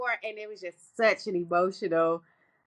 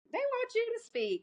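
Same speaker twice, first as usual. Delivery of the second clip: coaxing dad; 5 dB quieter than usual. Retold or imitated. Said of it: "And we like".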